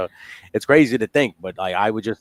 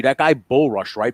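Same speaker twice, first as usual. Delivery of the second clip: ordinary talk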